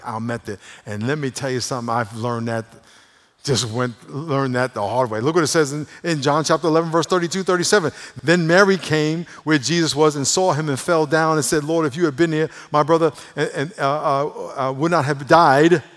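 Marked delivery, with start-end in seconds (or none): 5.2-11.6 loud, raised-pitch scripture reading; forceful preaching. Real, not acted. Preacher reading how Mary came, forcefully. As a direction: drive the verses out to the congregation.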